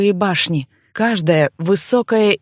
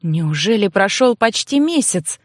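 usual speaking voice

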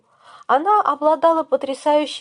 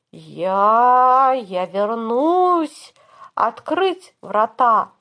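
slow, drawn-out lich voice; slow villain vow; 3 dB louder, much slower than usual. Acted. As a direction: stretch each word like a dying lich. Fleeing lich casts escape spell.

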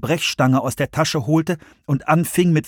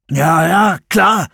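higher, louder, impatient yes-yes; sceptical Russ; 6 semitones higher, 6 dB louder, much slower than usual. A narrator's reading. Russ to George outside the alarmed building, impatient. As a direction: brush it off, edgy and tense.

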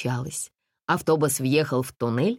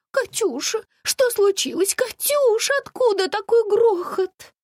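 panicky lady; 4 dB louder than usual. A narrator's reading. Panicky lady calls her son.

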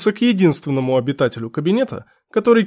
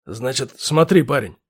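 gruff lieutenant; 5 semitones lower, 4 dB quieter than usual. Acted. Lieutenant voiced.

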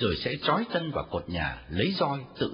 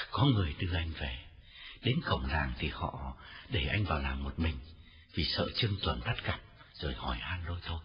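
lower, softer, worn-out voice; tired, weak reply; 9 semitones lower, 5 dB quieter than usual. A narrator's reading. Feverish Tân tells his sisters how he got home, weary. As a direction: speak through exhaustion with a faint smile. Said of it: "nở nụ cười mệt mỏi nói".

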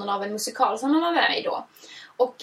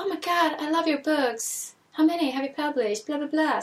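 gushing fan; 5 semitones higher than usual. Retold or imitated. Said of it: "vände hon sig mot honom och bara".

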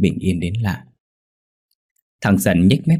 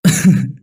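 short loud laugh; a short mocking guffaw; burst of laughter; 5 semitones higher, 5 dB louder than usual. A narrator's reading. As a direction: laugh out at his friend. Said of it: "Thằng Dần bật cười".

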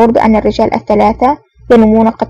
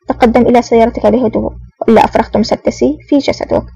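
ordinary delivery

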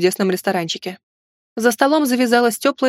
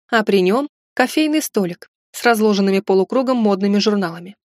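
ordinary delivery, nothing marked

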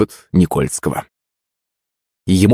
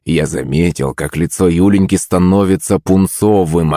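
ordinary delivery

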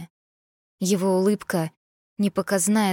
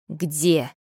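cold hiss; 3 semitones lower than usual. A narrator's reading. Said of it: "прошипела я холодно и безжалостно".

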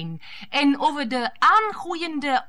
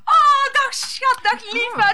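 gushing welcome; 9 semitones higher, 3 dB louder than usual. Acted. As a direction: coo over him like a lovesick fan. Mistress gushes greeting Freek home.

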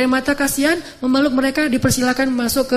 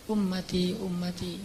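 very soft, fading call for his people; dying whisper; 5 semitones lower, 12 dB quieter, slower than usual. Acted. The dying Prophet calls his people, faint fading plea. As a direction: let the words fade like a last breath.

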